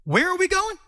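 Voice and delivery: lightly voiced eager Peter; curious Peter. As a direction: Peter pestering Jesus for answers. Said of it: "Peter would have been like".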